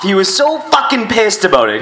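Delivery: usual speaking voice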